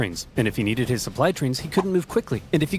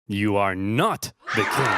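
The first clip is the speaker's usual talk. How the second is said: slow, weighty stress on not; dramatic reveal; 4 semitones lower, slower than usual. Acted. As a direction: let not land like a verdict. Dramatic test result reveal.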